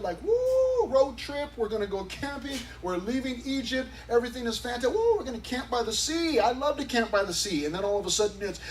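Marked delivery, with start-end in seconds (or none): 0.2-7.7 high, giddy, cheering voice; mock excitement. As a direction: play it like kids off on a road trip. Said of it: "people were all excited".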